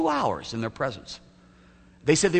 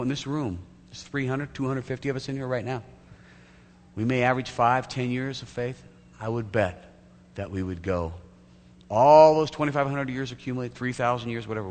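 ordinary talk, nothing marked

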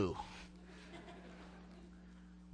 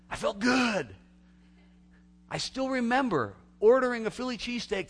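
proud exclaim; 11 semitones higher, 7 dB louder than usual. Real, not acted. Pleased memory of work.

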